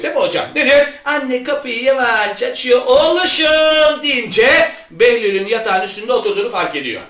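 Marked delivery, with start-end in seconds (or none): 2.9-4.0 drawn-out, higher-pitched motherly call; doting mother. Acted. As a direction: call sweetly to your son, stretching it. Mother calls to her son.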